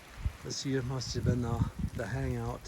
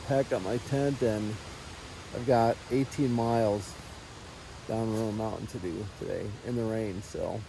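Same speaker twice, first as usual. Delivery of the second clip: ordinary talk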